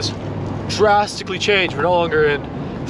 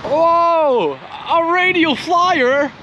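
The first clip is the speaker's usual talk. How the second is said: high amazed exclamations; 11 semitones higher, much slower than usual. Real, not acted. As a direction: gasp at how big it is. Amazed at a big object.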